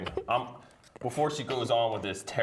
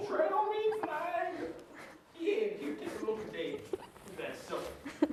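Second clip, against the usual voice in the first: proud country flag-owner; 5 dB quieter than usual. Acted. Showing the hosts a flag, in a high country character voice.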